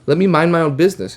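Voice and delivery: high, loud and fed up; indignant outburst. Retold or imitated. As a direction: snap back at the clerk, cutting him off. Cuts off clerk, indignant outburst.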